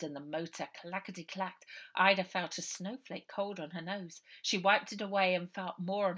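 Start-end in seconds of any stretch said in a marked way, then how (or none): none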